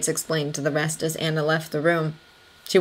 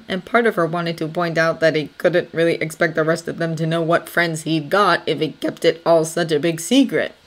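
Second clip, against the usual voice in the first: ordinary delivery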